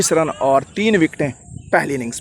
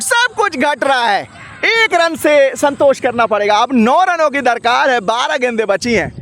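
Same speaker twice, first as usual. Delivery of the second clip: excited commentary; 12 semitones higher than usual. Real, not acted. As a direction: shout the run-out drama. Run out chance, tense finish.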